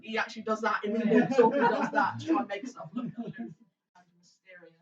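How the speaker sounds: loud laughing explanation. Real, not acted. GM laughing at his NPC.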